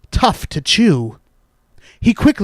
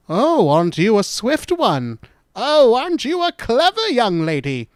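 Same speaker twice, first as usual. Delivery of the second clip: higher, lilting praise; imagined admiring cry; 4 semitones higher than usual. Acted. Little Red imagining praise from stranger and grandmother, admiring cry. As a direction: gush over a child in an approving grown-up voice. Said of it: "they would cry".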